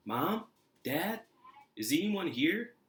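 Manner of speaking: put-on boy calling out. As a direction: call hopefully for your parents, voice lifting. Boy searches house for parents.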